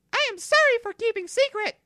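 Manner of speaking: very high, quick squeaky creature apology; a tiny pet creature owning up, high and fast; Eevee voice, apologetic. Acted. Eevee apologises to Sarah in a quick high squeak.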